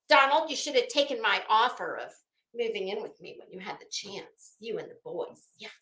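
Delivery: ordinary talk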